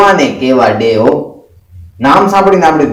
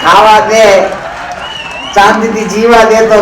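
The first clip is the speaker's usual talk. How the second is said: nagging wife; 4 semitones higher than usual. Retold or imitated. Husband imitates his wife demanding pills and food, mock nagging. Mocks wife's demands after marriage.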